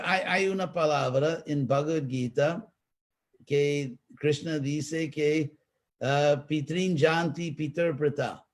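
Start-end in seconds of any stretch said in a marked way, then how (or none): none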